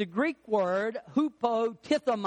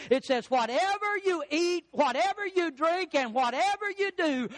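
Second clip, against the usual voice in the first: raised-pitch pulpit proclamation; forceful preaching; 5 semitones higher than usual. Real, not acted. Preacher quoting the Bible to congregation, fervent proclamation.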